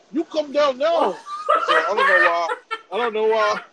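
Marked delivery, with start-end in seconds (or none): 0.1-1.1 higher-pitched mock Indian accent; put-on Indian accent, insistent command. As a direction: imitate a parent ordering in an Indian accent. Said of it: "I made them have that accent".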